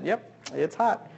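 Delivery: soft, slow, hushed consoling; consoling dad. Retold or imitated. Dad softly consoling his burned baby, slow and hushed. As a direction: gently soothe the crying child. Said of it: "I try to console him".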